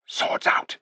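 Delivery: urgent whisper; whispered order. A narrator's reading. King whispering orders to his companions. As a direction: breathe the command to the others. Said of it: "he whispered to the others".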